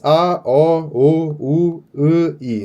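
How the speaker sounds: A, O, U, I spelled out clearly one by one; vowel demo. Shown as a demonstration. Teacher reading vowels aloud for the class. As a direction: model each vowel for students. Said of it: "a gente lê como se fossem as vogais".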